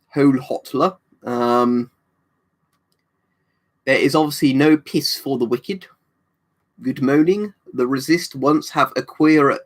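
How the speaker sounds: put-on slow mangled French-accented English, vowels all wrong; Officer Crabtree voice. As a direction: Crabtree from Hello Hello, mispronouncing every line. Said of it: "Crabtree quotes".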